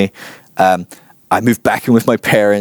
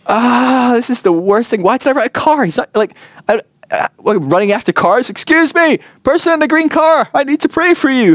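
much higher, breathless, desperate pleading; frantic mock plea. Running after cars, high frantic mock call. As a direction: chase the car calling out in a high panic. Imagines chasing green car.

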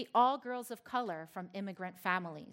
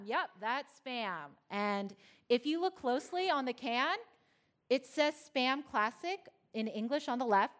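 ordinary talk, nothing marked

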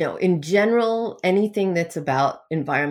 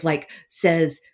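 usual speaking voice

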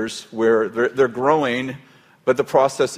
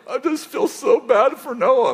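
high, slow, over-sad pity voice; mock weepy voice; 13 semitones higher, 3 dB louder than usual. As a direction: whimper it like a weepy mourner. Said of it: "I'm like".

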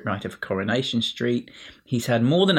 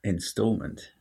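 ordinary talk, nothing marked

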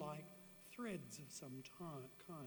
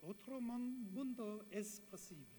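Spanish phrase in a Spanish accent; 5 semitones higher than usual. Real, not acted. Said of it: "Excuse my Spanish accent".

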